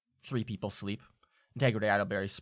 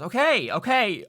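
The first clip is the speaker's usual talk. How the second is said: flustered okay; 12 semitones higher, 9 dB louder than usual. Acted. Kurt flustered at leaving.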